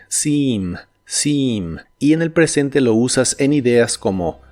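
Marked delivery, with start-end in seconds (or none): 0.1-1.8 seem said slowly with a long doubled ee, sim. Shown as a demonstration. Teacher modelling seem for Spanish learners, long ee. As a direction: stretch the ee in seem. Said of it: "se pronuncia con una I larga o doble".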